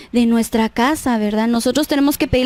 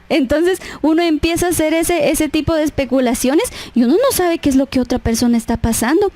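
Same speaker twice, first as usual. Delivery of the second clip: ordinary talk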